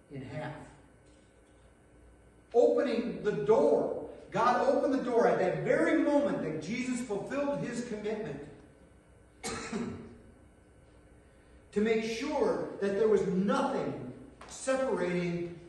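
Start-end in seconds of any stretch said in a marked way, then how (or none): 2.5-13.2 louder and higher, building fervour; preaching climax. Real, not acted. Preacher proclaims to the congregation that God opened the door, ringing. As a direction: lift it to a ringing peak. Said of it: "preaching climax".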